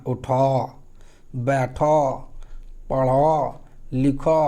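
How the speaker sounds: उठो बैठो पढ़ो लिखो with a changed, drawn final ओ; slow rubbed Angika imperatives; Angika o endings. Shown as a demonstration. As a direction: drag out each final ओ. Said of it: "आपको आवाज़ बदलना होगा".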